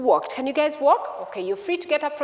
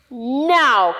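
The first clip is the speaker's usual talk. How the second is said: long, loud, insistent word; insistent storybook demand; 10 dB louder than usual. Acted. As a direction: stretch it out forcefully. Son demands money at once.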